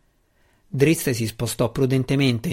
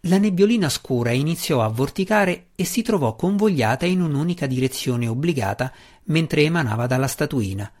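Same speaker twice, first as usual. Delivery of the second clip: ordinary delivery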